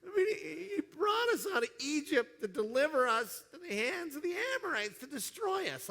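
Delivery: put-on high, whining teen complaint; whiny teenager. Israelites complaining God brought them out, whiny high teen wail. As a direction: wail it like a sulky fourteen-year-old. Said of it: "like a whiny fourteen-year-old boy".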